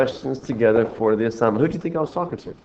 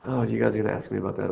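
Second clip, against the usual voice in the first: normal talking voice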